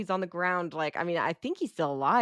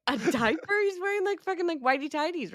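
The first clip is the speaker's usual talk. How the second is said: high-pitched, real disbelief; incredulous; 11 semitones higher than usual. Real, not acted. Cohost challenges the diaper claim, incredulous. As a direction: question it in high-pitched astonishment.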